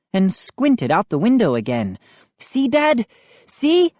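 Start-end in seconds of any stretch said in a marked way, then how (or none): 2.4-3.9 high excited mock cry; happy cry. A narrator's reading. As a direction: show Dad, overjoyed. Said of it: "I cried happily".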